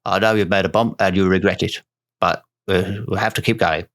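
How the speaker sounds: low-pitched, earnest butler voice; old retainer impression. Butler consoling his master in a Batman parody, low and earnest.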